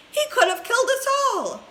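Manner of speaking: put-on very high, loud cracked old-lady voice; grandma protesting in alarm; shrill old woman. A narrator's reading. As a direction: screech it like a scared granny. Grandma continues her outburst.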